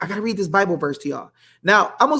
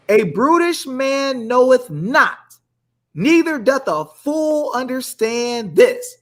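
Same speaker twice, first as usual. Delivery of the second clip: slow, higher preacher reading; preaching scripture; 4 semitones higher, slower than usual. Acted. Host preaching psalm to viewers, slow and lifted. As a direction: stretch each word like from the pulpit. Reads verse preacher style.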